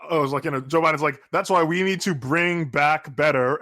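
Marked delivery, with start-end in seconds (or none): 1.3-3.6 slow, each word hammered separately; mock Biden leaning on every single word; imitating a slow, over-stressed campaign slogan; emphasis on every word. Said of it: "emphasis on every word".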